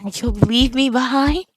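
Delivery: a hint of a clingy girl's hurt; hurt Fomar.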